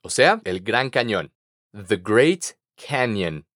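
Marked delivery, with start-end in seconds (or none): 1.7-3.5 I would like said very slowly for learners; slow model phrase. Shown as a demonstration. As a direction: spell out I would like slowly, word by word. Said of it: "Repite conmigo".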